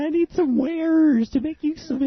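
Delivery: put-on high, slow old lady voice; quavery granny asking for wares; mock grandma. Old lady asking a boy for yarn, high and slow.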